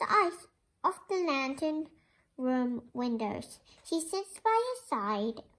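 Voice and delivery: gentle, measured read-aloud voice; storybook reading. Acted. Teacher reading a picture book to the class, gentle storytelling.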